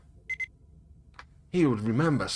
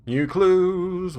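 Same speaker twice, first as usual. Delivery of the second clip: excited; 7 semitones higher, 6 dB louder than usual. Real, not acted. Excited at game alert.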